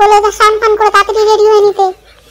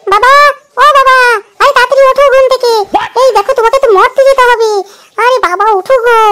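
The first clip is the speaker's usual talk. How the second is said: high, raised wake-up call; calling out loudly; 4 semitones higher, 4 dB louder than usual. Acted. Daughter waking her sleeping father for his devotees, calling out loudly. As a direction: call to rouse a sleeper, easing slightly. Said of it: "এত চেঁচামেচি করছিস কেন".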